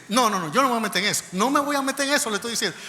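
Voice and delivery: loud, high, insistent brush-off; emphatic refusal. Retold or imitated. Lieutenant refusing to get involved at the embassy door, emphatic refusal. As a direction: refuse forcefully, imitating an exasperated officer.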